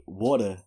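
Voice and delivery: "water" with a New York "waw" vowel; New York pronunciation of water. Shown as a demonstration. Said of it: "New Yorkers say water weird".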